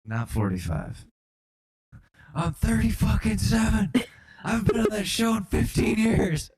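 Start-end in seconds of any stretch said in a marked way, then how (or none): 2.3-6.5 high-pitched mock tirade; comic outraged rant, pitched up; indignant outburst. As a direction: protest your age indignantly. Acting indignant actor, age corrected.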